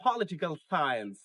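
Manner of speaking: "পলিটিক্যাল সায়েন্স" as PO-li-ti-kal SAI-ens; correct pronunciation. Shown as a demonstration. Teacher repeats "পলিটিক্যাল সায়েন্স" correctly, SAI-ens falling. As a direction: let two-syllable "সায়েন্স" fall.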